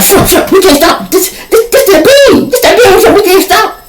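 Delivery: very high, laughing panic; imitating a frightened friend's squeal; panicky squeal. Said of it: "And Kim like".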